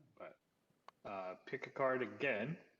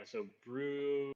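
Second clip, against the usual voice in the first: ordinary talk, nothing marked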